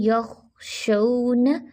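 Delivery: यख, शऊन drawn out, zabar on शीन lifted; careful recitation; slow tajweed drill. Shown as a demonstration. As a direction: lift the शऊन gently upward. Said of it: "ध्यान रखिए".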